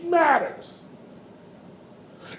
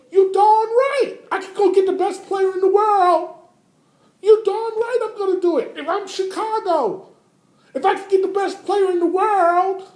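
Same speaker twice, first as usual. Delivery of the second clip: playing the Houston boss, much higher and louder, in a put-on voice; hammed-up Houston boss; 6 semitones higher, 3 dB louder than usual. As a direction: shout it like the Houston GM grabbing the best player. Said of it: "if I'm Houston".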